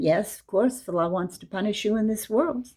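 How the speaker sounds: ordinary delivery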